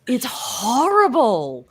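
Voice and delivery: high, loud, stretched-out complaint; exaggerated dismay. Real, not acted. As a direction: draw out the outrage. Mock outrage at bad French.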